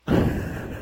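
breaks up laughing mid-read; soft wheezy burst of laughter. Real, not acted. Cracks up while reading letter.

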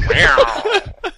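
high laughing meow; cat voice. Pretend. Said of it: "Fumo Jive's cat".

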